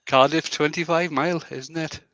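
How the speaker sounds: lightly lilting mock Welsh, rising at the end; Welsh accent. Shown as a demonstration. Showing the Welsh sentence ending, mock accent. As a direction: put on Welsh, softening the final tag. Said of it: "with a Welsh accent".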